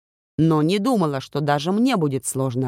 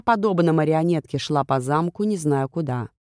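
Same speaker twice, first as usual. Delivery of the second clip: ordinary delivery, nothing marked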